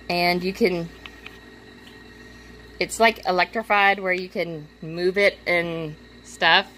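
usual speaking voice

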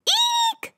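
very high, squealed mock scream; high storybook scream. A narrator's reading. PJ scared by the movie, small high-pitched scream. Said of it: "screamed Potts".

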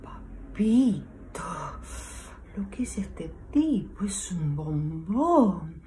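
slow, unvoiced lustful whisper; breathless whisper. Woman whispers lustfully about the handsome man at the bar. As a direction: whisper it like you're drooling.

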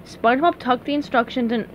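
ordinary delivery

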